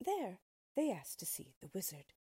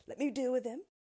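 higher, louder, determined voice; bold Tin Woodman; 6 semitones higher, 8 dB louder, faster than usual. A narrator's reading. Tin Woodman protecting friends from wolves, loud and bold. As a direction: step forward to defend them.

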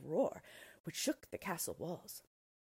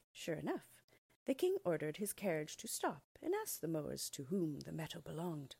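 usual speaking voice

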